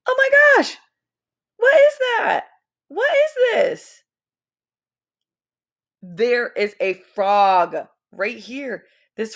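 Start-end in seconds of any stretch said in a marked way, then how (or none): 0.1-4.0 high, loud excited wonder; excited gasp. Acted. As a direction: gush with amazement over the picture. Teacher excited over pictures.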